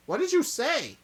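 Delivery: high, loud disbelieving cry; shocked outburst. Acted. A friend shocked by Kujo's confession, loud and high. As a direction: blurt it out in shock.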